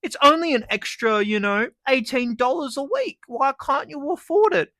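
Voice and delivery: mocking internet cronies. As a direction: sneer it up high like a smug commenter.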